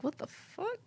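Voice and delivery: hushed, high-pitched mock disbelief; stunned disbelief. Retelling her reaction to the stranger's request, stunned disbelief.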